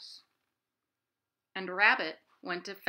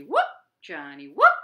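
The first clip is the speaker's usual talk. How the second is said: louder whoop on the rise; 14 dB louder than usual. Shown as a demonstration. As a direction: whoop it out loud for the kids. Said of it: "Wanna do it again?".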